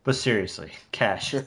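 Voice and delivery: lightly done Australian gangster demanding cash; mock Australian tough guy. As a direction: drop low and play the Australian standover man. Quoting Chopper's demand for cash.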